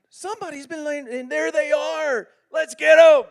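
tiny bear finding the intruder, rallying the family; baby bear. Acted. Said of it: "Little baby bear".

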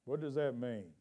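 ordinary talk

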